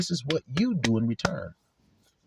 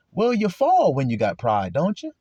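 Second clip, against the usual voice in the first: normal talking voice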